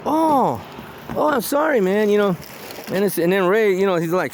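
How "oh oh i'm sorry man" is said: high mock apologetic neighbor; a lightly voiced sorry; sheepish apology. Neighbor realizing his mistake, sheepish apology. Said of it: "He goes".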